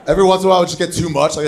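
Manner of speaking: ordinary delivery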